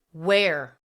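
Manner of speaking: loud, intense one-word demand. A narrator's reading. Assassin pressing the tearful man, intense. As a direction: fire the question at him hard. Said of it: "Her voice wasn't any less intense".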